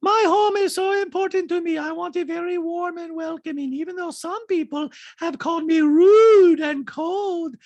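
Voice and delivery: very high, loud caricature with stretched words; Diana impression. Reality star defends her warm home, high loud caricature. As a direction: squeal it out in exaggerated accented falsetto. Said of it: "so Diana goes".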